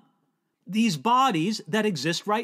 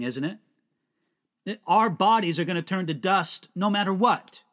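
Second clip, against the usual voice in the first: ordinary talk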